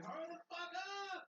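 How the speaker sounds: high-pitched imitated yell, urging someone on; geschreeuw naspelen: mock yell. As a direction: yell it high, like squabbling teammates. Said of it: "Yelling at each other like".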